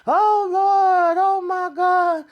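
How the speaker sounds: high, slow, wailing fake sobs; mock fake crying. Host mimics Victoria's fake grief, high mock wailing. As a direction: ham up a phony grieving mother's wail. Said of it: "and the sniffles and putting the tissue up to her damn nose ... as if she crying".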